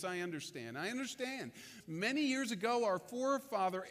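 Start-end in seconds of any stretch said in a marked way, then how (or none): 0.0-0.8 low, soft I understand with understand falling gently; Jesus calmly soothing Nicodemus, low and gentle; low reassurance. Retold or imitated. 0.8-1.5 brighter repeat. Retold or imitated. Repeated reassurance, higher.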